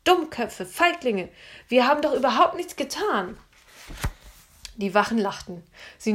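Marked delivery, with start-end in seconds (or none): 0.0-3.4 higher, louder angry scolding; girl scolding her captors. A narrator's reading. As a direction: tell the guards off hard. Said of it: "wie Anne die Wachen beschimpfte".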